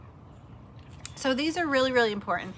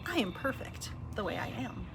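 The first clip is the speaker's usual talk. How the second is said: snippety; 3 semitones higher, 8 dB quieter than usual. Retold or imitated. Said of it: "a little snippety about it".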